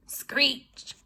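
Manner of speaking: plain reading voice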